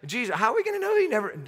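quick, high, anxious disciple asking Jesus; a disciple fretting to Jesus, quick and high; worried disciple. Pretend. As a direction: rush it out like a doubtful follower. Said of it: "start to think like you're there".

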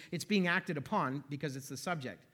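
ordinary talk

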